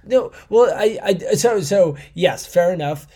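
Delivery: flustered surprised backtrack; sheepish realization; caught out. Real, not acted. Caught out, Maradona still alive.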